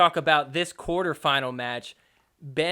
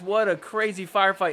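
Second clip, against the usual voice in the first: ordinary talk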